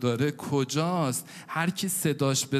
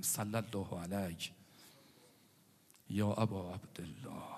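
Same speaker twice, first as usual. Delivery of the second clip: soft devotional salutation; 6 semitones lower, 11 dB quieter than usual. Acted. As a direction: intone it quietly to the Imam. (سلام آرام و کشیده به امام)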